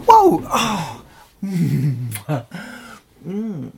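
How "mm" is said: usual speaking voice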